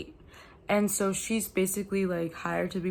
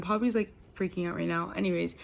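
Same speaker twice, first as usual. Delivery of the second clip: ordinary talk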